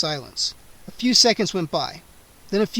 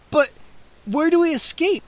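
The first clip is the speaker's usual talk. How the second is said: loud high demanding question; frightened demand; 11 semitones higher, 5 dB louder than usual. A narrator's reading. Scene: Freddy demands the way out from Gwendolyn, loud and high. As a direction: demand an answer, loud and higher. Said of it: "I demanded".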